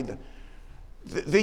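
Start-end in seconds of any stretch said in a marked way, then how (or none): none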